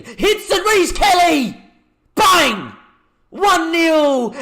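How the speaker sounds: high, loud ecstatic goal yell; roaring the winner home; goal celebration. Real, not acted. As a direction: scream it like your team just scored. Goal scored, commentator erupts.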